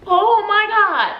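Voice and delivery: high, slow, overwhelmed cry; imitated tearful awe. Dancing with strangers on her birthday, tearfully overwhelmed. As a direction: draw it out high as if welling up. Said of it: "I started crying again".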